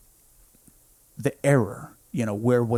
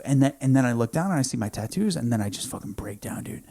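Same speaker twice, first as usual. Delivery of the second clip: usual speaking voice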